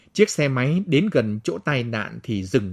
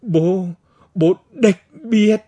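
put-on higher, louder drunken slur; drunk slurring; 7 semitones higher, 6 dB louder, slower than usual. A narrator's reading. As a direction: belligerent drunk blurting. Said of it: "Giọng lè nhè lại cất lên".

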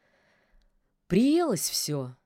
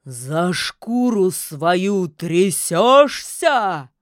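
very loud, slow villain's growl; thundering, drawn-out jeer; angry taunt; 9 dB louder, much slower than usual. Acted. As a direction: roar the taunt, drawing it out. Enraged Koschei taunts the witch.

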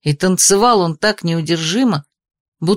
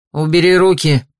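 loud cry; 2 semitones lower, 3 dB louder than usual. A narrator's reading. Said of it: "громко крикнул он".

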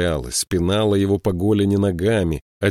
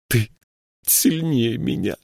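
higher, slow moaning words; 5 semitones higher, slower than usual. A narrator's reading. Exhausted Varsheva moaning in Joshua's grip. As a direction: groan out defeat. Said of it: "простонала Варшева".

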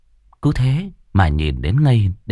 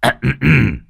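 loud, pompous clearing of the throat; 3 semitones higher, 4 dB louder than usual. Acted. Moneylender clears throat loudly to compose himself. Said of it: "Lão hắng giọng".

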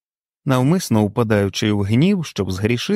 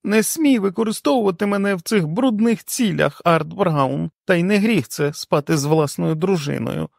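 higher voice of a wife scolding her husband; 8 semitones higher than usual. A narrator's reading. Wife refusing husband in bed, scolding higher voice. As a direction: tell him off firmly.

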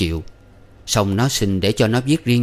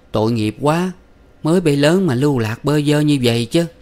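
pitying lady; 6 semitones higher, 3 dB louder than usual. A narrator's reading. Lady pities the children.

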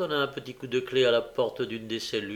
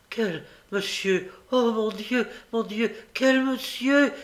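much higher, slow, rising panic; frantic cry; 9 semitones higher than usual. A narrator's reading. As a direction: cry out in growing alarm. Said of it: "cria Nancy".

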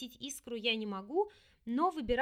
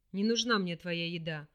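low, sharp demon snap; curt; 5 semitones lower, 4 dB louder than usual. Acted. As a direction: throw it off sharply. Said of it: "резко бросил он".

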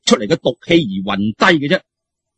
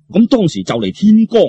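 ordinary delivery, nothing marked

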